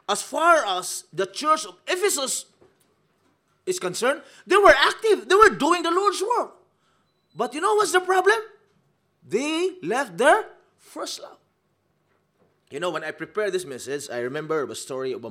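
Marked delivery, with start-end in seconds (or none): 0.1-11.4 high, loud pulpit preaching; preaching at full force. Acted. Preacher hammering the point to the congregation, loud and high. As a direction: lift the room with a loud, high sermon.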